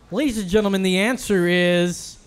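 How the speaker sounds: slow, building announcer reveal; game show reveal. Pretend. Announces the answer to contestants.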